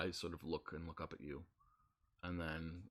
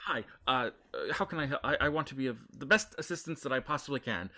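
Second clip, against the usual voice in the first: higher, louder, eager to please; flustered goblin clerk; 5 semitones higher, 5 dB louder than usual. Acted. Goblin clerk flusters eagerly at noble visitor, higher and louder. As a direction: overeager service, voice up.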